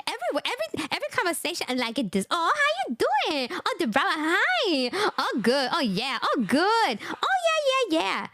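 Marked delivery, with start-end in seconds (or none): 2.3-8.3 sing-song small talk, swooping up high; mocked non-English speaker. Imitating women who answer everything with pleasantries, swooping mock chirp. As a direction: gush empty pleasantries, pitch bouncing up and down. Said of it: "This is how they talk".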